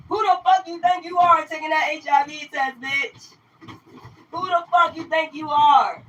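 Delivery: a hammed-up mock of the angry commenter; mocking hater voice. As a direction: parrot the hater's outrage in a shrill put-on voice.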